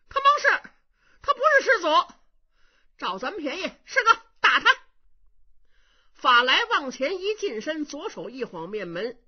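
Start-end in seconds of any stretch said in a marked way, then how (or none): 0.1-4.7 loud and high, almost crying; excited outcry. Acted. Young monk urging his brother to hit the impostor, excited shout. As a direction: yell for your brother to attack.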